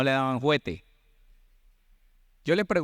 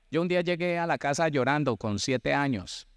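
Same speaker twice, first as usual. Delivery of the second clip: normal talking voice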